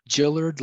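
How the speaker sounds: "Gillard" as JILL-erd, J sound first; name pronunciation demo. Shown as a demonstration. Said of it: "my name is pronounced".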